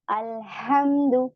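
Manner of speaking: अल्हम्दु slow, with the l of अल clearly sounded; clear lam in al. Shown as a demonstration. Teacher shows the pupils the spoken lam in अल्हम्दु. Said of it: "वहां पे कमरी हो गया".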